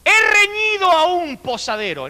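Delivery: high, slow, lofty dictation voice; hammed-up formal teacher; mock teacher's dictation. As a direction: recite it like a prim old schoolmistress. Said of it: "y dijo".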